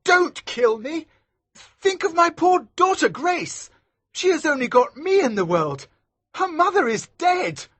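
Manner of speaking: begging for life. Acted. Maskew begging Elsevier, high frightened plea. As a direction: plead desperately for mercy.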